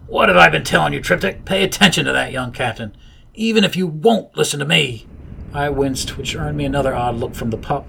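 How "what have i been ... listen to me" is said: higher and louder, insistent scolding; shouting inner voice. A narrator's reading. Inner captain scolds the narrator, louder and higher. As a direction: shout it through the skull. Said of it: "empathy shouted".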